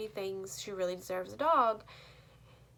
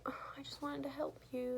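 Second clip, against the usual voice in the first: soft, higher-pitched mock hurt; sad little sister; 5 semitones higher, 6 dB quieter than usual. Little sister offering to help, mildly sad. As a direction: imitate a let-down little sister, quietly. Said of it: "she's kinda sad about it".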